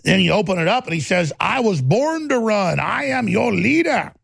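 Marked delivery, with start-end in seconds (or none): 1.4-4.1 slow, grandiose campaign speech, lightly voiced; mock Beto O'Rourke speech. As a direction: ham up a messiah candidate, drawn out. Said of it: "He does this fake talk like this".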